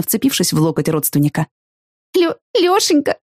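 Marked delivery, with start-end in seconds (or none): 2.1-3.2 high, slow, breaking into tears; tearful cry. A narrator's reading. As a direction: sob out his name in relief. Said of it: "Из моих глаз брызнули слёзы".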